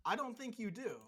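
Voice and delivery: usual speaking voice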